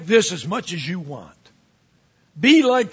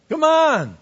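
much higher, louder exasperated cry of come on; 9 semitones higher, 6 dB louder than usual. Acted. Pastor mocks the church's tolerance, high exasperated cry. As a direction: throw it up high in disbelief. Pleading with congregation, repeated.

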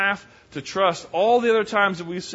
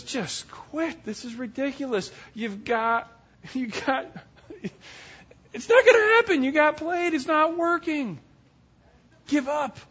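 higher, rising to an exasperated outburst; fed-up wife; 8 semitones higher than usual. Retold or imitated. Wife losing patience with her husband, exasperated outburst. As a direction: make her snap that he got played. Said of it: "She says".

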